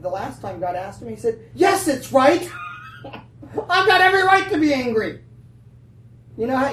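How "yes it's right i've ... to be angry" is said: high, loud outburst of indignation; angry Jonah. Acted. Jonah answering God about his wallowing, angry shout. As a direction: yell it back in defiance. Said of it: "he was angry because of it".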